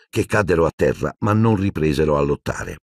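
plain reading voice

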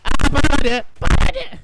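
loud, high yelled callout; real frantic shout. Real, not acted. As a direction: bellow the warning at the teammate. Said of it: "peaking bad when I shout".

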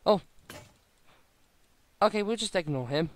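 normal talking voice

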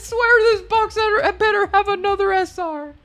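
high laughing demand; giggly begging the pack; laughing plea. Real, not acted. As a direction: laugh while pleading with the box.

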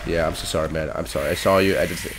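ordinary talk, nothing marked

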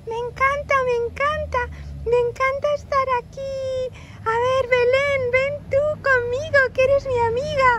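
put-on very high, squeaky child voice; delighted little child. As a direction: squeal with joy like a small kid. Child Oscar delighted, invites Belén.

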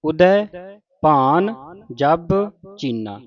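slow model recitation. Shown as a demonstration. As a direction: recite word by word slowly, dropping at the end. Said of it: "ਉਦੈ, ਦੱਦੇ ਨੂੰ ਦਲਾਵਾਂ ਲੱਗੀਆਂ". (ਹੌਲੀ ਹੌਲੀ ਪਾਠ, ਅਖੀਰ ਤੇ ਨੀਵਾਂ)

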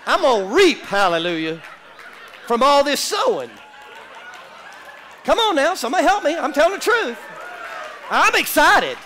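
loud, high, rousing preacher shout; preaching at full force. Real, not acted. Preacher firing up the congregation, loud high shout. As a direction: whip the church up, climbing loud and high.

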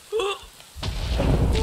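loud startled gasp; sharp gasp. Real, not acted. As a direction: gasp in fright. Sudden scare at night.

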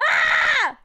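sudden high loud scream of fright; real shriek at a scary picture; startled scream. Real, not acted. Streamer startled by her own photo, real loud scream. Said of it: "That was scary".

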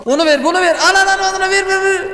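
very high, repetitive panicked chant; frantic. Real, not acted. Player handing out orders against the clock, frantic. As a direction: pitch climbing into a frenzy. Frantically serving as time runs out.

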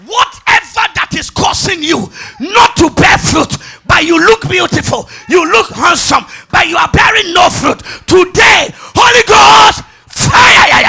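high, shouted revival prayer; full-force preaching. Acted. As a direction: shout it to the congregation, building to a scream.

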